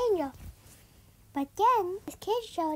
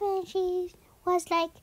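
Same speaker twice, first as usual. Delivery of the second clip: usual speaking voice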